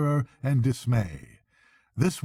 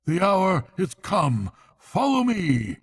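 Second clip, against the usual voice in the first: higher, louder, slow rallying shout; battle cry; 7 semitones higher, 3 dB louder than usual. A narrator's reading. Badger leading his friends into the hall, loud battle cry. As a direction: rouse your comrades to charge. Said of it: "and cried".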